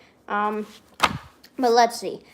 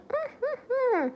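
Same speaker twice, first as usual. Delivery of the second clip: very high-pitched giggle; high laugh; 5 dB quieter than usual. Real, not acted. Streamer reacting to own pun, high giggle.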